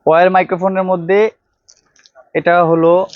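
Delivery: normal talking voice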